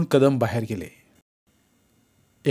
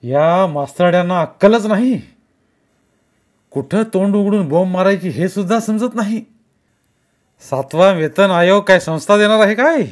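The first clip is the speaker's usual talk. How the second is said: loud contemptuous tirade; scornful rant; 6 semitones higher, 6 dB louder than usual. A narrator's reading. Kumar ranting at the teachers, loud and scornful. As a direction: pour scorn on them.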